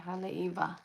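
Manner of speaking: Haleiwa with the W pronounced as V. Shown as a demonstration. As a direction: model Haleiwa with a clear V sound. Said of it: "The W is a V".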